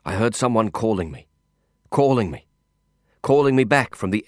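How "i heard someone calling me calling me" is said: ordinary delivery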